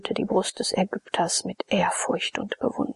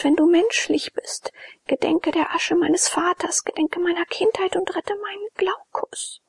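put-on higher young woman's voice, desperate and begging; a kneeling girl begging for her beloved, higher; Jone pleading; 7 semitones higher, 4 dB louder than usual. A narrator's reading. Jone begs Abakes to save Glaukus, higher and pleading.

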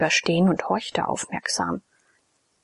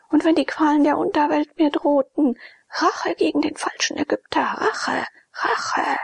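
high, raised vow of revenge ground out; vengeful fury; 7 semitones higher than usual. Acted. Betrayed priest swears revenge on the Egyptian, ground through teeth. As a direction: spit Rache through clenched teeth. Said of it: "zwischen den Zähnen knirschte".

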